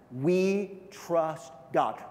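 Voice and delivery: "We trust God" drawn out very slowly; very slow, deliberate; very slow emphasis. Acted. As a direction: stretch every word.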